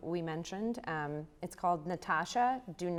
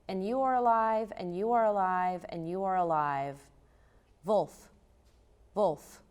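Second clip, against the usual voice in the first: poetry performance; 4 semitones higher than usual. Acted. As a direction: chant the repeated lines like a rising spell. Poet performs her poem Wolverine.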